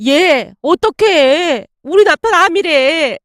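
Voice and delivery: high, slow, tearful plea breaking out; choked up, near tears. A narrator's reading. Friend tells mom her husband has cancer, on the verge of tears. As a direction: hold back sobs as you blurt the bad news. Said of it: "울먹이며 말을 꺼냈습니다".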